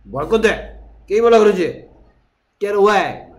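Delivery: dad yelling at daughter; angry dad. Retold or imitated. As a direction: scold the gaming kid away. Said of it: "일이 먼저다".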